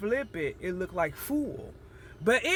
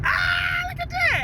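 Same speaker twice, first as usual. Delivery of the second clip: loud, much higher excited cry; 6 dB louder, slower than usual. Real, not acted. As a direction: shout it out, amazed. Excited outburst at passing sight.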